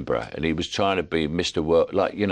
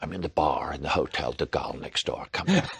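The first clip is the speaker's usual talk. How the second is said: John Huston impression; 4 semitones lower, 5 dB quieter than usual. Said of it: "That sounds like John Huston".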